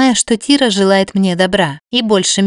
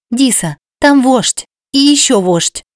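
higher, slow excited cry; exclaiming; 5 semitones higher than usual. A narrator's reading. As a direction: blurt it out in alarm. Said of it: "воскликнула девушка".

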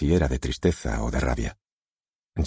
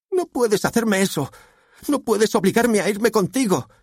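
high-pitched, frantic protest; outraged teenage plea; desperate daughter; 3 dB louder than usual. A narrator's reading.

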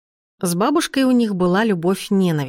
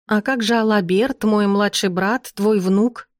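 ordinary talk

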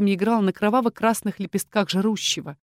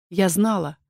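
ordinary delivery

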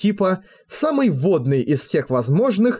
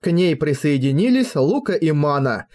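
ordinary delivery, nothing marked

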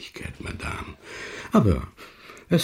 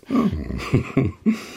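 sly chuckle; 5 semitones higher than usual. Acted. Lheureux laughs slyly.

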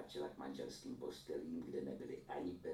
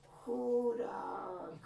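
louder, higher cry of pity; 9 dB louder than usual. A narrator's reading. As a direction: pity the poor saint out loud. Pitying exclamation. (hlasitý soucitný povzdech)

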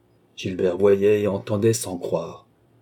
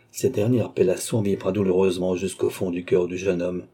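usual speaking voice

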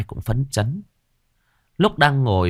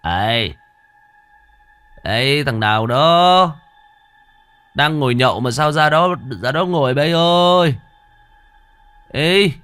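put-on slurred drunken shouting; drunk slurring; 7 semitones higher, 5 dB louder than usual. A narrator's reading. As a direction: call out loud, slurring drunk. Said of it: "kêu lên bằng cái giọng lè nhè".

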